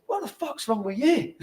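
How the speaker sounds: angry manager. Retold or imitated. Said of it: "he went to me".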